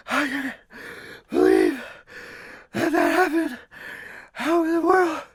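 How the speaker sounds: very high, gasping words one breath apart; hammed-up winded squeal; breathless disbelief. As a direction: wheeze it out, utterly spent and stunned. Acted exhausted runner loses third race.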